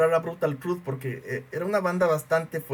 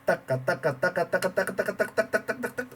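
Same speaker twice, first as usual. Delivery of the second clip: rapid taca taca drum sounds, softer; drum beat imitation; 2 semitones higher, 4 dB quieter than usual. Shown as a demonstration. Imitating [name] drumming, quick vocal drumming. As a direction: rattle off a one-foot drum pattern. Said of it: "con un solo pie".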